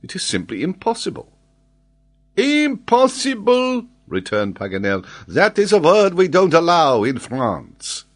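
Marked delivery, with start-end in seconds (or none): 0.0-1.3 higher-pitched, unforced dismissal; dismissive. A narrator's reading. Glenarvan doubts the geographer, dismissive. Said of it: "shrugging his shoulders". 2.4-3.9 very high, loud, stretched exclamation; indignant French geographer. Acted. Geographer throws Glenarvan's word back, indignant. 5.3-8.0 put-on high, loud French-accented protest; indignant French geographer. Geographer rejects the word for France, indignant.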